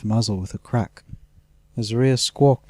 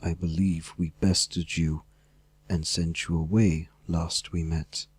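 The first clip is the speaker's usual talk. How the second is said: a lightly lowered rabbit-folk voice, calm and defiant; rabbit folk disguise; 5 semitones lower, 3 dB quieter than usual. Acted. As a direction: play the humble rabbit, quietly standing firm.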